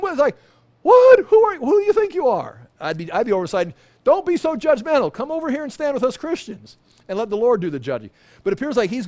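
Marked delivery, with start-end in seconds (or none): 0.8-2.7 very high, loud outraged squawk; shocked indignation. Pretend. Pastor voicing believers outraged he stays aside, very high. 4.0-8.1 mocking scold. Scolding comrade.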